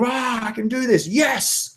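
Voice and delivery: high, held-back triumphant yell; imitated scream. Attendee re-enacting the scream before walking across fire, high and exultant. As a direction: psych yourself up with a high cry. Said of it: "use our body and scream".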